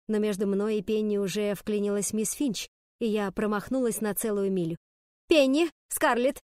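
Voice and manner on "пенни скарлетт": loud teacher calling pupils to order; sharp call. A narrator's reading. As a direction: call each name out, higher and louder. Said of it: "снова окрикнула мисс Финч". (строгий громкий окрик)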